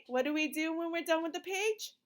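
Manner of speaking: ordinary delivery, nothing marked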